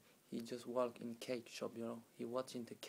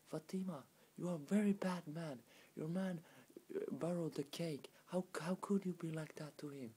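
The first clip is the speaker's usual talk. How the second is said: raised, higher-pitched reproach; scolding neighbors; 5 semitones higher, 4 dB louder than usual. Retold or imitated. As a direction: act out neighbors telling her off. Neighbors scold Fatima in story.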